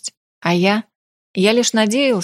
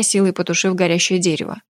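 ordinary delivery

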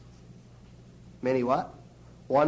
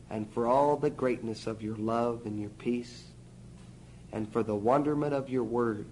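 soft, hushed prayer; 3 semitones lower, 7 dB quieter than usual. Real, not acted. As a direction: pray quietly and sincerely. Prays softly to the Father.

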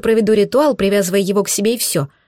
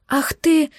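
higher, outraged hiss; indignant hiss; 7 semitones higher than usual. Acted. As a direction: spit it out in outrage. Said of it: "зашипела я мысленно".

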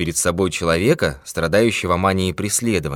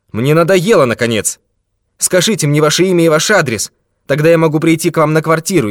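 loud, high, fed-up demand; exasperated; 11 semitones higher, 8 dB louder than usual. A narrator's reading. Fed-up detective demanding name and address, exasperated. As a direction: raise the voice, out of patience.

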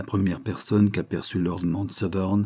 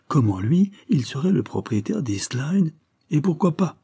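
higher, incredulous questioning; disbelieving exclamation; 7 semitones higher than usual. A narrator's reading. Young woman doubts Carlyle owns the estate, exclaiming incredulously. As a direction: question it in astonishment, pitch lifted. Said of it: "s'écria la jeune fille".

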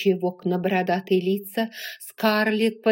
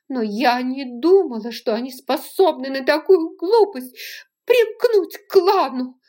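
anguished outburst; 5 semitones higher, 6 dB louder than usual. A narrator's reading. Scarlett's guilt-ridden inner outburst.

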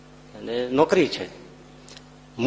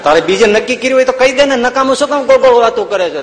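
exasperated scolding; 8 semitones higher, 8 dB louder, faster than usual. Retold or imitated. Swami scolds the boy.

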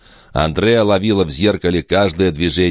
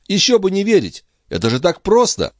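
forced cheerful reassurance. Acted.

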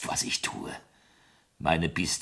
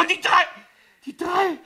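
choking shock; 5 dB louder than usual. Acted. Victim chokes on the demand.

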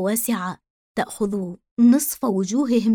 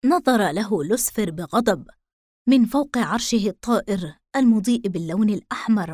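plain reading voice

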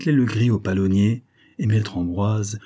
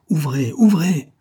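higher, louder, slow calls through a door; urgent calling; 7 semitones higher, 5 dB louder, slower than usual. Acted. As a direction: call her awake, insistent. Farmer knocks urgently at door.

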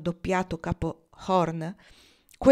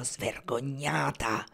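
low, slow snarling growl; a captain snarling at the woman he hates; 5 semitones lower, 4 dB quieter, much slower than usual. A narrator's reading. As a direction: spit it out low and slow, full of contempt. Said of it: "Egli ringhiò".

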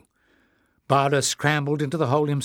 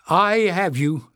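raised voice, higher; calling out; 6 semitones higher, 5 dB louder than usual. A narrator's reading. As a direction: call it out firmly. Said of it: "Leif called".

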